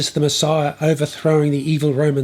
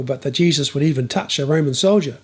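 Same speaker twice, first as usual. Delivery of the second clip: plain reading voice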